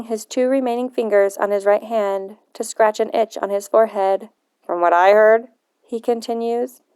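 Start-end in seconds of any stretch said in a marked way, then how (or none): 4.6-5.5 lowered grandfather's voice, stern and probing; a touch of a suspicious old man; grumpy old man. Acted.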